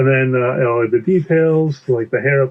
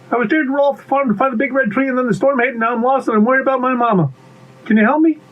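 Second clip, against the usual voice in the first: high, rushed, anxious character voice; worried little pea; 11 semitones higher, faster than usual. Acted. Lost pea begs bee for help, worried and rushed. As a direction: blurt it all out in a high voice.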